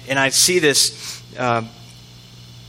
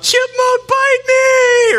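put-on high, drawn-out toddler scream; 6 dB louder, much slower than usual. Retelling a childhood scare to his parents, screaming bloody murder. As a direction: shriek it like a panicked little kid. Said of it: "I'm screaming bloody murder".

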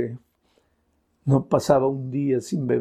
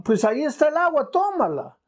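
urgent insistence; 12 semitones higher than usual. Acted. Preacher urging, pitch soaring.

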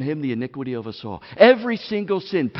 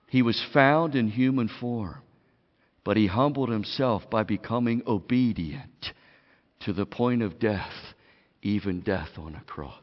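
scripture reading; 7 semitones lower, 6 dB quieter than usual. Acted. Reads Paul's text softly.

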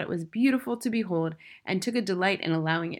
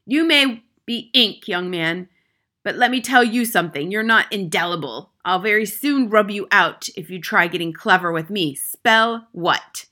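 loud, menacing headmistress; overbearing, raised-voice threat; threatening; 2 semitones higher, 8 dB louder than usual. A narrator's reading. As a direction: warn the pupil forcefully.

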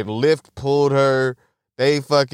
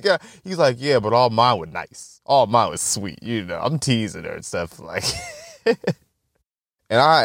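usual speaking voice

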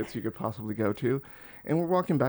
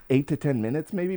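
ordinary delivery

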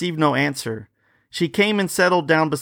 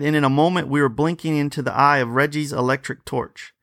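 usual speaking voice

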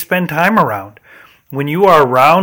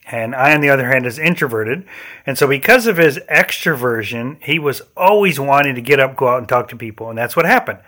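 normal talking voice

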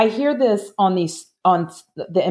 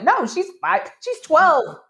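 high-pitched mock protest; indignant; 11 semitones higher than usual. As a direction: imitate an upset teenager setting people straight. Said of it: "make me upset sometimes".

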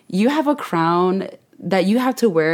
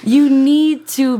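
emphatic; 7 semitones higher, 6 dB louder, much slower than usual. Real, not acted. Urging listeners to get massage.

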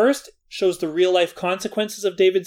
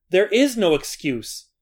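ordinary talk

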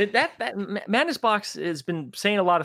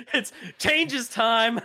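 very slow, drawn-out, higher and half laughing; menacing dad meme; 7 semitones higher, much slower than usual. Retold or imitated. Blizzard as the meme dad announcing punishment, slow and laughing. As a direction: drag it out like a dad holding a belt.

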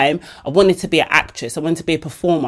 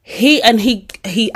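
usual speaking voice

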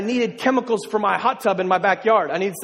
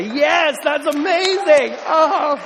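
loud, higher, drawn-out yes; excited cheer; 6 semitones higher, 5 dB louder, much slower than usual. Shown as a demonstration. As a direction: show them how to cheer it. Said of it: "that was a good place to really clap".

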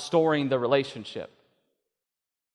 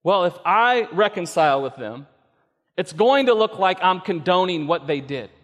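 higher, louder worried objection; anxious objection; 5 semitones higher, 6 dB louder than usual. Retold or imitated. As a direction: voice their fretting doubts. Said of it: "Another objection".

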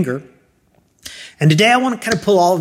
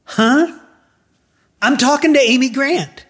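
high, astonished yelp of realization; retold amazement, pitch leaping up; starstruck surprise; 12 semitones higher, 4 dB louder than usual. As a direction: blurt it out, stunned. Stunned to reach the star.

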